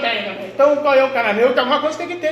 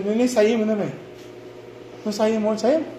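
ordinary delivery

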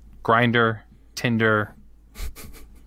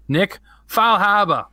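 put-on slow, drawn-out New England accent; 8 semitones higher, 4 dB louder, much slower than usual. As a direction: stretch the name out in a thick New England accent. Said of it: "I'm gonna try it in my most New England accent possible".

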